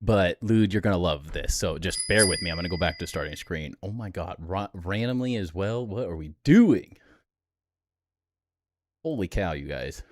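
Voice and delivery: ordinary talk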